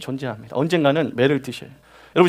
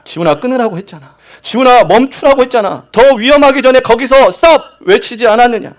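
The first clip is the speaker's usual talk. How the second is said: rising, louder pleading rebuke to a straying believer; God's pleading warning; 4 semitones higher, 4 dB louder than usual. Acted. God warning Jihun, a rising pleading rebuke. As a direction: plead with him, then press harder.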